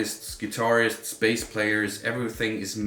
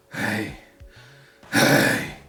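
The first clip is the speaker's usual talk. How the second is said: light rasp; 8 dB louder than usual. Shown as a demonstration. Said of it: "just go really light first".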